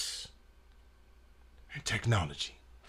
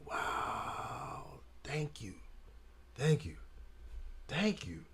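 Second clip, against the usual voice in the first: stunned whisper; 15 dB quieter, slower than usual. Retold or imitated. Stunned by a stranger's gift.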